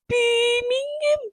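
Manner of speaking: high-pitched mock Brummie rant; hammed-up Birmingham accent. Vlogger trashing her hometown Birmingham, exaggerated Brummie accent.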